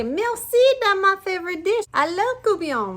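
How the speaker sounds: put-on high, eager boy; little boy voice. A narrator's reading. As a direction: answer sweetly like a hungry kid. Jacques answers the lady.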